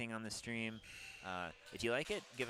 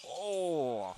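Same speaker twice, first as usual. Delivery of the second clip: surprised; 4 semitones higher, 8 dB louder than usual. Real, not acted. Sudden near miss on the field.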